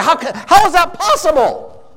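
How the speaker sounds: incredulous. Retold or imitated. Customer who can't get the dish, hammed-up incredulity. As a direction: play the baffled customer for laughs. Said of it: "we are incredulous".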